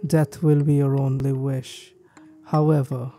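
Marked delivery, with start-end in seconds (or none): none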